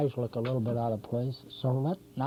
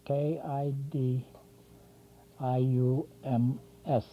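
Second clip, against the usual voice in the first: slow, letter by letter; spelling it out; slower than usual. Shown as a demonstration. Lecturer spells his coined word for viewers, spelling it out slowly.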